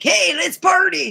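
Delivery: high, loud and bouncy, a cat begging for fun; eager kitten voice; cat's party voice. Retold or imitated. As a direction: voice the kitten, all hyped up to party. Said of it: "he ran up to me like".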